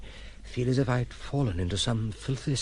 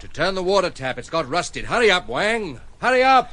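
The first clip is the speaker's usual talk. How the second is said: loud, high urgent command; urgent shout; 9 semitones higher, 8 dB louder than usual. Acted. Master shouting at servant to hurry with crowbar, urgent. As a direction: push him to hurry, shouting.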